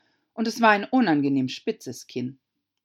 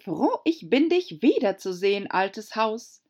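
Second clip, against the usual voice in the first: a lightly higher, cheerful duchess voice; Herzogin, erfreut; 6 semitones higher, 5 dB louder than usual. A narrator's reading. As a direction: greet Alice like an old friend, gushing. Herzogin spricht Alice an. (Herzogin begrüßt Alice überschwänglich, höher und lauter)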